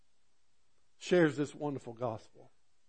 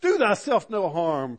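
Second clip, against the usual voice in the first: raised, slow call of warning; loud cry; 6 semitones higher, 8 dB louder than usual. Retold or imitated. Paul calling to the jailer, a loud slow cry. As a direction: cry out to stop him. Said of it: "cried with a loud voice".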